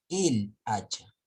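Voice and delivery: "el hacha" slowly and deliberately; slow demo. Shown as a demonstration. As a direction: spell out "el hacha" slowly for the class. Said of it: "Entonces, esto sería".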